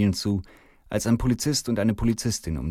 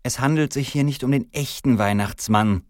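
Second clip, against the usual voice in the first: high, emphatic female officer; policewoman; 6 semitones higher, 4 dB louder than usual. A narrator's reading. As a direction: correct him as a policewoman. Fügt die Polizistin noch hinzu.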